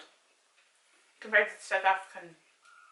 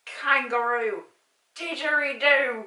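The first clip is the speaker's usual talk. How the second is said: loud hammed-up Aussie words, slowing down; terrible Australian accent; 3 semitones higher, 8 dB louder, much slower than usual. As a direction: shout out Aussie clichés in a bad accent. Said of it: "It's horrific accent".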